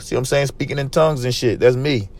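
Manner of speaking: ordinary delivery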